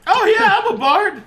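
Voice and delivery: eager, high and loud; excited. Acted. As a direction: proudly blurt it out. Bard proudly answers.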